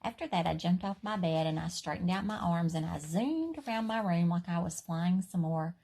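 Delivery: usual speaking voice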